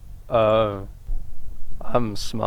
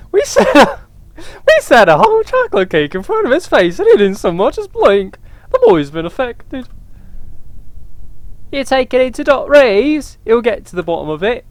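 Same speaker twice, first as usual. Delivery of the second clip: worried mother voice; 8 semitones higher than usual. Acted. Mother worrying the boy is affected, high voice. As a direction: fret about the boy in a high voice.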